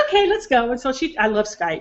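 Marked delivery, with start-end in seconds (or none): 0.1-0.7 high, bright-pitched eager okay; eager agreement. Retold or imitated. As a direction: chime in like a playful grandma ready to go. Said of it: "And I said".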